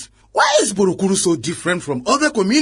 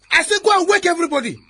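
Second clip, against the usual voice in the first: repeated order; 9 semitones higher, faster than usual. Acted. Officer repeating order angrily.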